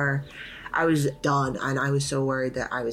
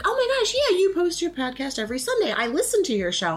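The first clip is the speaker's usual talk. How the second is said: much higher, louder excited gush; gushing fan; 13 semitones higher, 3 dB louder than usual. Retold or imitated. Michelle recognizing the podcast, high and gushing. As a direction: gush like a starstruck listener. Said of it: "And she's like".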